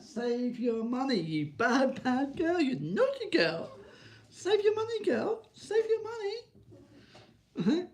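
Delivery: very high, sing-song pet voice; baby talk to dog. Pretend. Talking to his Jack Russell, squeaky baby talk.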